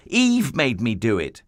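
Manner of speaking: Adam blaming Eve to God; voicing Adam blaming. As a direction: pass the blame like a child.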